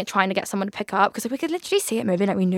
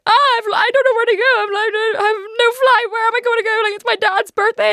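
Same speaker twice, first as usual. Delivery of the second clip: much higher, fast, wailing and tearful; panicked crying wail; 13 semitones higher, 5 dB louder than usual. Retold or imitated. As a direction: reenact the airport breakdown, near sobbing. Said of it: "I was crying my eyes out".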